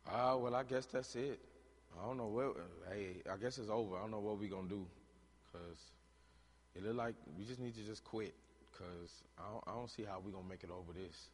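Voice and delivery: soft, low, giving-up voice imitated; defeated talk. Imitating a hopeless believer in hard times, soft and defeated. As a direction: sigh it out like someone who has quit. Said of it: "to speak like this".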